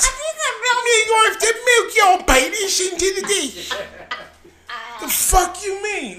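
shouting through laughter, high and slow; laughing shout. Retold or imitated. Laughing shout retelling the doctor's order. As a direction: yell it while cracking up.

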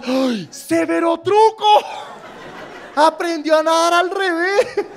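put-on high, slow, wide-eyed kid voice; a little kid thrilled by the trick; childish amazement. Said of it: "uno no sabe, yo". (niño asombrado, agudo y lento)

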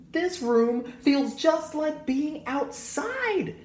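put-on high, slow childlike exclamation; excited exclaim. A narrator's reading. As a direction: marvel at the room. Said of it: "Aiden exclaimed".

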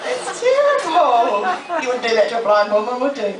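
high, loud, laughing protest; mock outrage at being pranked. Real, not acted. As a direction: protest while still laughing.

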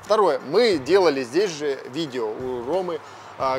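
ordinary talk

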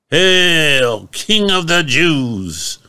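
high, slow, sneering mock homage; mocking hail. Soldiers mocking Jesus in a purple robe, sneering hail. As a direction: jeer it like a soldier bowing in scorn.

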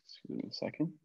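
breathy whisper, turned away from the class; quick whispered excuse me; whispered aside. Real, not acted. Teacher excusing herself from the class, whispered.

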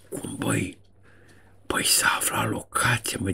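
hushed, mocking whisper. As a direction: whisper it like a conspirator caught out. Mocking the worried generals. (șoaptă batjocoritoare)

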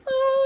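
long, high cartoon scream. Reacting to the milk mishap, long high scream.

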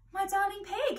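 put-on obliging young lady on the phone.

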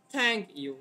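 normal THANK, then YOU dropping low; falling away on you; stump, middle to low. Shown as a demonstration. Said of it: "আপনি thank-টা স্বাভাবিক".

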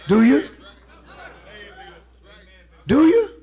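loud, high-pitched challenge to the congregation; demanding shout. Real, not acted. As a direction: shout it out, pressing for an answer. Pressing congregation for an answer.

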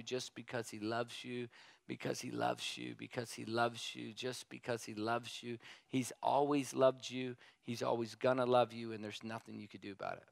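preacher softly repeating that God loves you; soft preaching refrain. Acted. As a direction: lull the crowd with it over and over.

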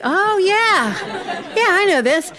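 teasing the fishermen in the crowd; mock know-it-all audience. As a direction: play the nodding crowd pretending to know. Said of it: "you'll be like".

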